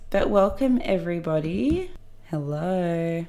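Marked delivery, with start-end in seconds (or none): 2.3-3.2 odd hello voice. Acted. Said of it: "Why did you say it like that?".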